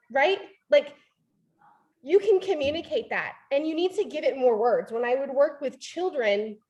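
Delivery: normal talking voice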